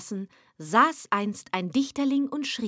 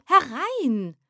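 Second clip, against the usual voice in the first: second call, raised high and louder; louder, higher call; 5 semitones higher, 4 dB louder than usual. Acted. Poet eagerly calls the visitor in, louder and higher. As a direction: call it out again, more eager.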